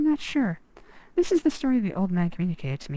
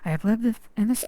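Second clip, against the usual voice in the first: ordinary delivery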